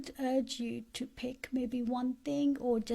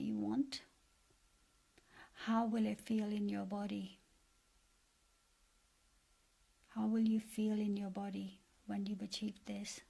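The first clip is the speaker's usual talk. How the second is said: guided meditation. Acted. Coach leading listeners to picture their best life, guided meditation. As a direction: guide them gently, leaving space after each phrase.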